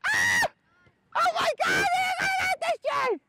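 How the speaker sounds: very high thrilled exclaiming; excited shout. Real, not acted. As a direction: shout it out in delight. Coach thrilled by a play.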